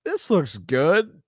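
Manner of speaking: mock innocent kid at the CD shop; naive teen voice. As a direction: drawl it like a clueless fifteen-year-old. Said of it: "And I was like".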